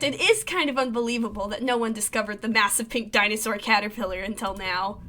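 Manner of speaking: ordinary talk, nothing marked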